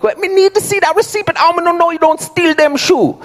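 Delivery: very high Jamaican patois; Jamaican security guard. Acted. Guard insists on seeing the shoe receipt, very high Jamaican accent. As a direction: push the Jamaican accent way up in pitch. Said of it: "the Jamaican security guard".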